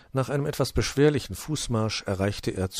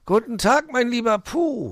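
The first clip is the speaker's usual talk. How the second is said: high, loud surprised fox greeting; astonished fox; 8 dB louder than usual. Acted. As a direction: call out in delighted surprise as the fox. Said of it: "rief er erstaunt aus".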